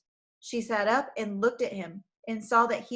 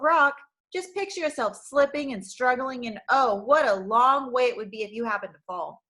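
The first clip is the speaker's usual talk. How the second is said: taunting sneer; 3 semitones higher, 6 dB louder than usual. Acted. Villain taunting her about the cliff, gloating sneer. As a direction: savour her fear as you mock. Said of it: "sneered Craven Fear".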